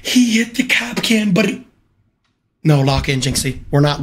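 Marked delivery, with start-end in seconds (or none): 0.0-1.6 high-pitched wail of protest; distressed disbelief. Real, not acted. Streamer protests the lost round to chat, high distressed wail. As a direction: lament it high, as if close to crying.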